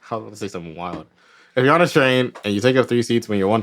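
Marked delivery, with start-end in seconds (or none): 0.1-1.1 soft, fast, sighing aside; quiet aside. Real, not acted. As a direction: mutter it under your breath quickly.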